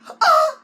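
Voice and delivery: very high, loud T-Rex scream; screamed roar. Shown as a demonstration. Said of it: "You can scream it out like this".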